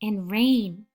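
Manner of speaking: put-on unhurried storytelling voice for a young child; picture book read-aloud. Teacher reading a picture book to a young child, gentle storytelling. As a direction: read it like a bedtime picture book, letting the pauses breathe.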